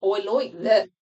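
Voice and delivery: slow, put-on Australian accent. Shown as a demonstration. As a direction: show off your Australian accent, drawn out. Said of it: "That's my Australian accent".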